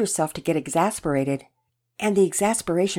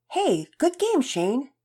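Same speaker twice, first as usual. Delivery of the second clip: higher-pitched, lightly voiced boy; friendly boy's greeting; 9 semitones higher, slower than usual. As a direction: call a friendly greeting up high. Boy greeting teammate after game.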